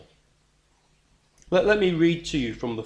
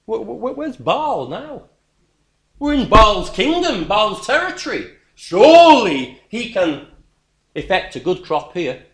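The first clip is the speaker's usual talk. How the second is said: high, loud mock taunt about the idol; sarcastic raised-voice taunt; 9 semitones higher, 8 dB louder than usual. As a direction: jeer it out, stammering.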